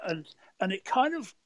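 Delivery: normal talking voice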